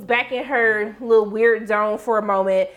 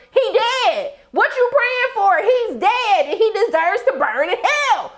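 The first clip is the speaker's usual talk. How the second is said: high, loud, drawn-out clapback at the TV; sassy shout; 10 semitones higher, 4 dB louder than usual. Acted. Shouting back at Serena praying on screen, sassy and loud. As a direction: shout the retort, high and slow.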